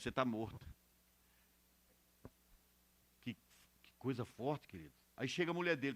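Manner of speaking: low, soft preacher's voice; quiet, low-pitched pulpit retelling; hushed sermon storytelling. Real, not acted. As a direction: drop right down for the grave story.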